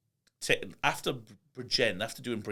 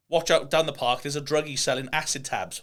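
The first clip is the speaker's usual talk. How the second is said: put-on raised voice like a parent warning kids; mock warning; 4 semitones higher, 6 dB louder than usual. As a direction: call out a village warning, half-joking. Mock warning from childhood village.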